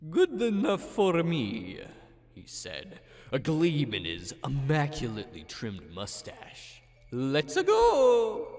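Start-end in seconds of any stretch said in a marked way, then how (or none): 0.1-1.8 put-on Italian accent. Mario voiced in story. 7.1-8.5 put-on Italian accent. Mario catchphrase.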